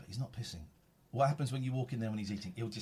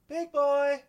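high drawn-out coo; cooing at a pet; 11 dB louder than usual. Real, not acted. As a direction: coo his name. Calling the cat.

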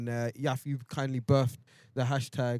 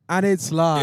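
excited announcement; 4 semitones higher, 10 dB louder, slower than usual. Real, not acted. Announcing they are live.

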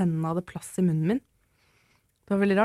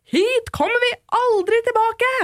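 much higher, louder, slow imitated scream; furious shout; 6 dB louder, slower than usual. Furious mother storms out of the emergency room, high loud scream. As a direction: yell it out like a fed-up mum storming off. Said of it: "mens hun skrek".

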